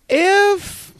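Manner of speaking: sudden squeaky high pitch. Real, not acted. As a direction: voice cracks up high. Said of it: "his voice was high there".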